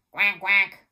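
two loud quacks; duck quack. A narrator's reading. Teacher reading the duck's part to children, loud quacking. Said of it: "called the duck".

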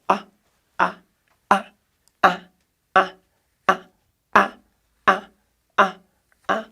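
repeated short 아 demos; 아 modeled for comparison; drawn 아 sound. Shown as a demonstration. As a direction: sound out 아 over and over. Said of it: "아자 봐봐".

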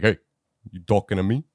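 a lightly done De Niro growl; tough-guy New York challenge, lightly done. Retold or imitated. Host voicing Eddie to the alley, light De Niro impression. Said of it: "That's my De Niro impression".

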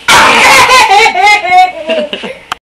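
high delighted laugh. Real, not acted. Mother laughs at toddler.

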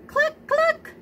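high clucking; chicken cluck. A narrator's reading. Chicken clucks at Duck, high pitched. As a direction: cluck it like a hen. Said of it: "said Chicken".

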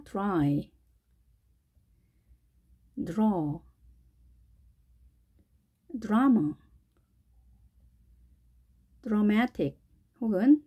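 each word read slowly and clearly, long pauses between; English word list reading. Shown as a demonstration. Teacher reading a dr word list to students, careful. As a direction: model every word carefully for learners to repeat.

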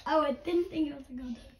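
normal talking voice

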